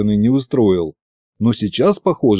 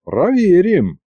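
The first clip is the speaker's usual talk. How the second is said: a touch of menace, higher; light threat; 7 semitones higher, slower than usual. A narrator's reading. Fedya warns the sorcerer, lightly threatening. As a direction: say it with a hint of a threat. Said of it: "с лёгкой угрозой протянул я".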